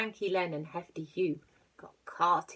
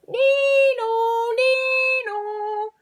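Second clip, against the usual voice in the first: siren sound; 14 dB louder, much slower than usual. Acted. Police siren imitation.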